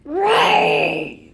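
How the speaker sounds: bear roar. Pretend. Kid roars like a bear.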